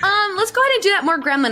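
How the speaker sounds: high, fast chipper director voice; mock director. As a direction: quote the director brightly and quickly. Director quoted giving notes.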